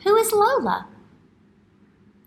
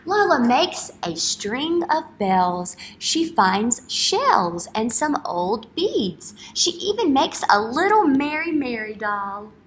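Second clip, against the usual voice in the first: louder, slow storytime read; reading to children; 3 semitones higher, 5 dB louder, slower than usual. Acted. Teacher reading a picture book aloud to kids. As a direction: make each page sound like a treat.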